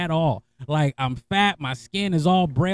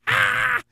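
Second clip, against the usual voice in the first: high mock shriek from the scale; mock scale scream. The scale reacting to his weight with a high mock scream. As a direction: squeal like a frightened bathroom scale. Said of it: "The scale was like".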